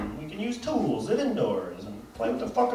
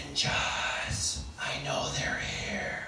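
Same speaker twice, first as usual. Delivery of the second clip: breathy mock whisper, a bit slow; mock inner-voice whisper; 7 semitones lower, 9 dB quieter, much slower than usual. Mocking Chuck Norris's inner thoughts, whispered. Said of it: "voiceover for his inner thoughts that he has to whisper".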